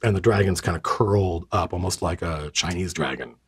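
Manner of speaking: ordinary talk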